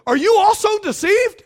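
high, loud indignant challenge; outraged Pharisees. Retold or imitated. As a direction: snap it at them, scandalised. Pharisees rebuke soldiers.

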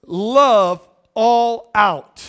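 very slow, stretched, weighty; slow emphatic refrain; drawn out. Real, not acted. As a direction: let each word hang. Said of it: "here's the last one".

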